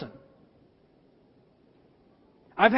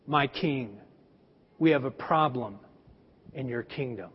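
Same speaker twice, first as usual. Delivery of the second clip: solemn Jedi-like prophet; 10 semitones lower, 6 dB quieter than usual. Retold or imitated. Prophet Nathan warns King David: low, hushed and grave. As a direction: speak it like a hooded Jedi with bad news. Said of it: "kind of like Luke in Return of the Jedi".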